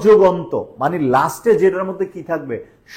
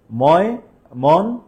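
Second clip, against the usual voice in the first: ময়, মন, সিং, হ each said slowly as a separate beat; syllable by syllable; 2 semitones higher, much slower than usual. Shown as a demonstration. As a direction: break Mymensingh into four slow pieces. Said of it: "কয়টা?".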